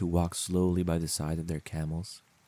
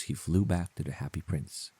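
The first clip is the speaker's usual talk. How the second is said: ordinary delivery, nothing marked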